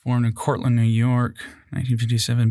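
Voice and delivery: usual speaking voice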